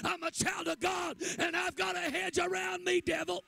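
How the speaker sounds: loud, high, shouted preaching to the church; preaching at full force. Acted. Preacher to the congregation, in a loud shouted preach. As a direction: thunder it at the congregation.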